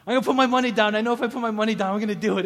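psyching himself up to commit; pumped resolve. Retold or imitated. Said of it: "I'm like".

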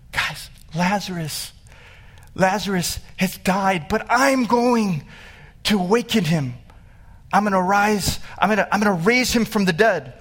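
Jesus thrilled to be raising his friend; excited Jesus. Retold or imitated. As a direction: announce it like good news you can't hold in. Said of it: "he's excited".

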